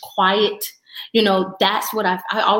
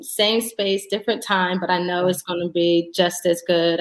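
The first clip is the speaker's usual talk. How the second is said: usual speaking voice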